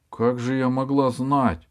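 surprised. Acted. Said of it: "удивилась Алиса".

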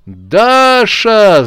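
high, loud joyful call; joyful shout. A narrator's reading. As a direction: call her name with delight. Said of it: "закричал радостно Ракета". (радостный крик «Даша!»)

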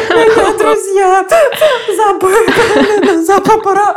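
high, slow sobbing through laughter; sobbing hysterics. Retold or imitated. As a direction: choke the words out in tears. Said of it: "я даже слова уже не могу выговорить".

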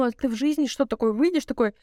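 ordinary talk

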